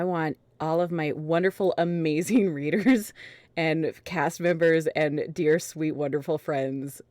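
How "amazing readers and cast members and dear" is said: ordinary talk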